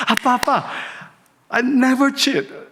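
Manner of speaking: put-on high-pitched eager girl's voice; teen daughter insisting to dad she never cheats; daughter protesting. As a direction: squeal it up high like an indignant teen. Daughter protesting to her father.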